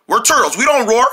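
ordinary delivery

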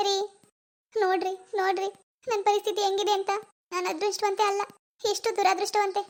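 put-on a grieving young woman close to sobbing; tearful daughter. Daughter grieving her lost baby to her mom, tearful.

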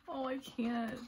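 ordinary delivery

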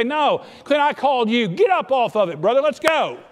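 a booming voice of God, shouted high; God calling Clint. Retold or imitated. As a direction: rouse him like a coach barking him to his feet. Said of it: "He'd say".

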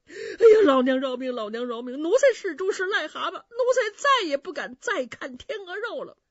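high, loud begging cry; whining plea. Acted. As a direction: grovel to her in panic. 冯子都再次求饶. (尖声哭求)

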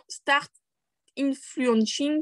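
English read slowly with a French accent; bad English accent. Real, not acted. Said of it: "un accent anglais très, très nul".

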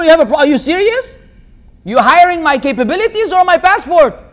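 ordinary talk

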